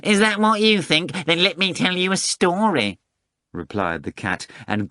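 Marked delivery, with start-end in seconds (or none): 0.0-3.0 a prim talking cat, taking offence; Behemoth the cat voice. Acted.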